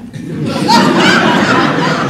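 loud squealing laugh; real delighted laugh; high burst of laughter. Real, not acted. Laughing at friend's remark.

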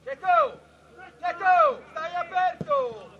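loud shout across the pitch; calling out. Real, not acted. Coach telling Cecco to stay wide, calling out. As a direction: shout the name, then the order.

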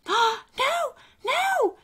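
very high little voice; panicked cry. Acted. Alistair is being carried toward Santa's house, panicked. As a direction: gasp and squeal in alarm. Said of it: "Alistair cried".

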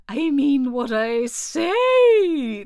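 slow, loud, drawn-out offended turtle; each word weighed, insulted. A narrator's reading. Mock Turtle offended by Alice's correction, slow and loud. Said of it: "in an offended tone".

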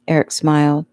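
ordinary delivery, nothing marked

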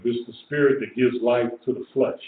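ordinary delivery

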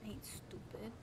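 soft whisper of defiance; whispered retort. Real, not acted. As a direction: whisper it back at the villain. Muttering back at game villain.

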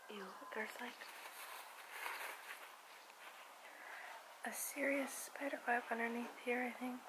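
hushed, breathy whisper about a spider web. Real, not acted. To viewers, spotting a spider web under the shelf, whispering. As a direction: whisper it as if not to disturb anything.

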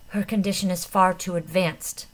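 ordinary delivery, nothing marked

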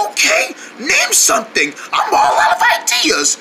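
lost voice. Acted. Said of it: "I lost my voice".

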